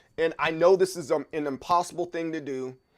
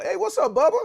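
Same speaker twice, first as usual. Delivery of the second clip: high, bright mocking "hey what's up"; teasing country greeting pitched way up; 12 semitones higher, 5 dB louder than usual. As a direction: sing it up high like a mocking good ol' boy.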